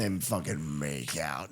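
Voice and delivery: a low, grumbly deadpan aside; mock low voice. A podcaster jokes to his friends in a low deadpan voice. Mocking the scene.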